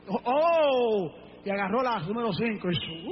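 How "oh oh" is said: big drawn-out "oh", low but forceful; acted exclamation "oh". Preacher acting out David picking a stone, exaggerated exclamation. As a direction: imitate a swelling cry of discovery. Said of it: "hizo así".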